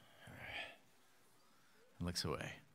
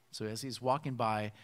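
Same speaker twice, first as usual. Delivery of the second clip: ordinary talk, nothing marked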